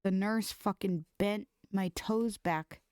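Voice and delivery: usual speaking voice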